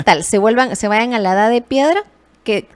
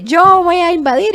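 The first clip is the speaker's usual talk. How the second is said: raised, high-pitched mock shout; 9 semitones higher, 6 dB louder than usual. Host voices China threatening Taiwan, mock shouting. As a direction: play a loud threat, pitched well above normal talk. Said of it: "estoy gritando".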